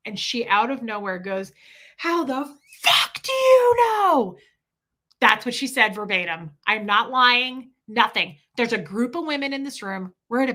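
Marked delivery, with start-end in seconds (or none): 2.0-4.4 slow, high, strained screech; enraged scream. Retold or imitated. Woman exploding at mother over teething remark, enraged scream. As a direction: scream it loud, stretching the words. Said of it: "I've never seen anybody scream like that".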